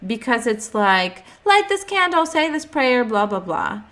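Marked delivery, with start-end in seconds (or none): 1.5-3.8 high, sing-song mock guru; mocking instructions. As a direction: parody a rote ritual checklist. Mocking rote spiritual instructions.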